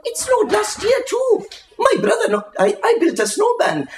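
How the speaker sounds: put-on high, eager childlike chatter; a little boy proudly telling his snow story; small boy voice. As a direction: narrator voicing a small boy remembering snow. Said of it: "a small boy says".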